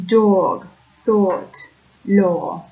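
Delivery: Brooklyn O as a diphthong: "dog" as "dawg", "thought" as "thawt", "law" as "lawr"; Brooklyn accent vowels. Shown as a demonstration. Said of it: "it would sound something like".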